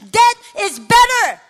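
high, very loud acted outburst; desperate shout. Worn-out daughter crying to God over her mother, shouting.

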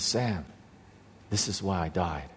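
hushed words, dropping low and quiet; still small voice. Retold or imitated. The Lord answering an angry mourner, still small voice. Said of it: "this still small voice".

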